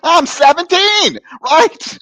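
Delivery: high, loud, hammed-up plea; exasperated teenage protest. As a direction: squawk it in mock desperation.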